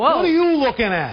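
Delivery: high-pitched Brooklyn challenge; Brooklyn accent. Acted. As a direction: pick a playful Brooklyn fight. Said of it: "I love a good Brooklyn accent".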